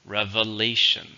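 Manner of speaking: revelation as rev-uh-LAY-shun, syllable by syllable; revelation, deliberately sounded out, weight on LA; pronunciation demo. Shown as a demonstration. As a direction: model revelation carefully, short e first, stress on LA. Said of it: "the pronunciation is very different".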